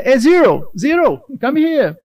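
slow called-out English. Retold or imitated. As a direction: call him over in English. Boss calling him, English accent.

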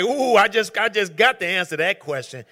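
mock-eager churchgoer blurting out; eager imitation. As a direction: play him itching to jump in. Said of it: "he like".

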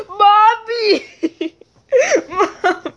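high, teasing mommy wail; mock scream. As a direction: make fun of a scared mama's boy. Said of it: "pretended that James was screaming".

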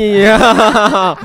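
high burst of laughter; loud laugh. Real, not acted. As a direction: laugh hard after the joke. Laughing at mother impression. (gelak besar)

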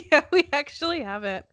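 normal talking voice

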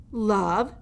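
mocking old jailer. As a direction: savour the mouse's claim with scorn. Gregory scoffs.

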